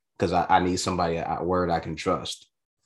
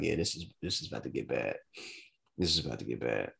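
worried inner thought; 6 semitones lower, 9 dB quieter, faster than usual. Retold or imitated.

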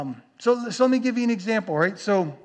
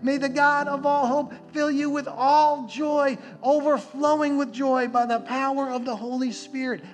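higher-pitched, rising sermon fervour; impassioned preaching; 5 semitones higher than usual. Real, not acted. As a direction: preach the promise with building conviction. Preaching scripture and its promise of joy.

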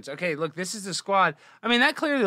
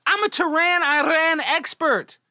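mock boast; 11 semitones higher, 5 dB louder, slower than usual. Boasting of being an Iran expert.

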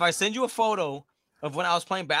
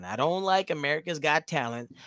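ordinary talk, nothing marked

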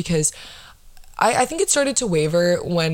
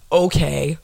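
ordinary talk, nothing marked

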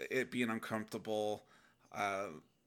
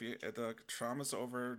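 ordinary delivery

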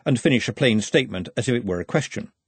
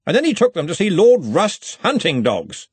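high, loud, hearty needling; jovial with a slight menace; threatening joviality; 8 semitones higher, 5 dB louder than usual. A narrator's reading. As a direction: crow it out cheerfully, pointedly. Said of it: "the same slightly threatening joviality".

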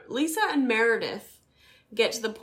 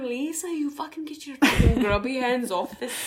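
lightly done Dublin scolding, a bit louder; mock Dublin accent; 4 semitones higher, 6 dB louder than usual. Imitating the Dublin gallery lady telling Lisa off, light Dublin accent. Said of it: "She's obviously from Dublin".

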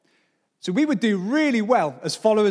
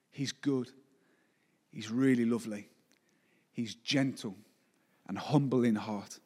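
much softer and lower, intimate; tender; 7 semitones lower, 7 dB quieter than usual. Acted. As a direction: speak of God gently, almost hushed. Tender quiet moment in sermon.

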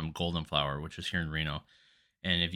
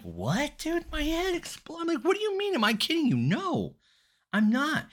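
high-pitched incredulous squawk; retold outrage, voice shooting way up high; indignant disbelief. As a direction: protest in a squeaky, exasperated pitch. Acts out indignant reply to beggar.

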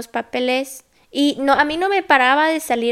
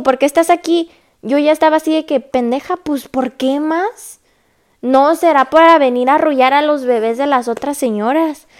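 sarcastic anger; 3 semitones higher, 5 dB louder than usual. Retold or imitated. Mocking nurse's dumb question.

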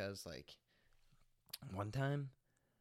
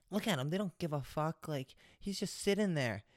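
nagging wife voice, pitched up; mocking the wife; 5 semitones higher than usual. Retelling the wife who scolded the smokers, pitched-up mock. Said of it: "the fucking bitch, his wife, the bitch, says".

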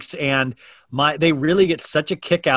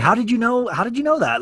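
mock surprise; 9 semitones higher than usual. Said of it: "I'm like".

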